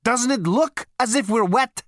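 high, loud, incredulous question; indignant question. A narrator's reading. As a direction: throw it out loud and high in protest. Indignant visitor challenges the man.

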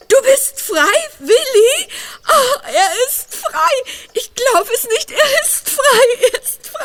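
crying with relief, then laughing through tears; tearful joy. Acted. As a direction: sob out the good news. Mother hears freed son on phone. (schluchzend vor Freude)

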